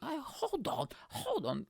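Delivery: suspicious listener. Pretend.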